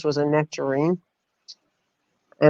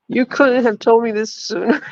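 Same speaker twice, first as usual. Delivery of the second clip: higher mock-scolding protest; mock outrage; 7 semitones higher than usual. Coworker scolding the narrator, higher mock outrage. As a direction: lift the voice high in playful reproach. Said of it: "she's like".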